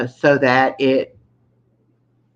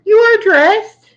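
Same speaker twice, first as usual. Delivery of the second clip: high-pitched protest; 8 dB louder, slower than usual. Real, not acted.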